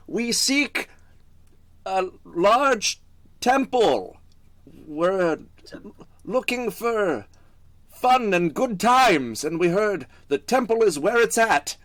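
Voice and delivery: high, loud drawn-out holler; yelling over. Acted. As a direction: call it out to people far off, stretching the words. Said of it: "I yell over to the".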